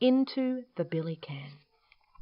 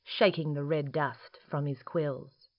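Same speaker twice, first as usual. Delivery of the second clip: usual speaking voice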